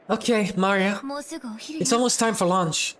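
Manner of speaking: coaxing mother. Acted. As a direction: coax the child to come along.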